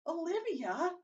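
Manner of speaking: high-pitched call for someone; shouting from the door. A narrator's reading. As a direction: call out the name from a doorway. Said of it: "shouting from the door".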